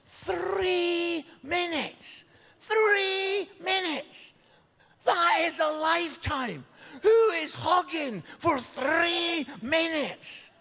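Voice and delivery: high, drawn-out disbelief; incredulous. Acted. Comic telling the crowd about an endless hug, incredulous. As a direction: stretch it out in outraged, high-pitched disbelief.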